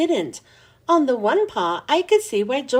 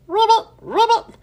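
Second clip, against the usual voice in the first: high, loud silly frog croak; a kid's mock frog croak; funny croaking voice; 10 semitones higher, 6 dB louder than usual. A narrator's reading. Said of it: "in a funny croaking voice".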